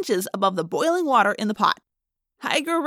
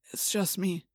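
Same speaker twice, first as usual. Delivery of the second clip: soft and quiet; 4 semitones lower, 8 dB quieter than usual. Acted. Father calming son at night.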